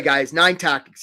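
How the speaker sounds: ordinary delivery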